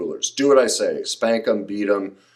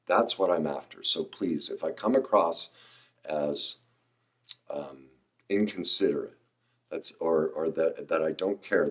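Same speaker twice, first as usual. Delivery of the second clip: softer, lower, earnest apology; sincere reassurance; 5 semitones lower, 7 dB quieter than usual. Real, not acted. Speaker calming viewers after his rant, softly sincere.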